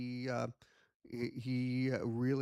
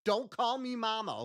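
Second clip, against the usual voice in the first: higher-pitched, exasperated objection; mock scolding; 9 semitones higher than usual. Handler scolds his puppet, mock exasperation. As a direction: protest to a cheeky puppet.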